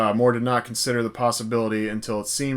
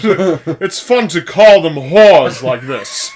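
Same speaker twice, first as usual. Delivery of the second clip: hammed-up, slow Bond voice, loud and higher; Sean Connery; 4 semitones higher, 11 dB louder, much slower than usual. Joking with co-host about Bond voices, hammed-up impression. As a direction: draw it out loudly as a mock iconic Bond. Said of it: "always gonna use the Connery voice".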